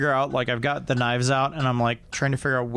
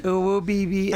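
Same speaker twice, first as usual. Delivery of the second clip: put-on Russian cam girl voice; 6 semitones higher than usual. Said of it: "Russian, uh, cam girl voice".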